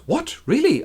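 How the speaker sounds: high, light startled question; astonished suitor. Acted. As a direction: perk up in sudden surprise. Suitor surprised by coincidence.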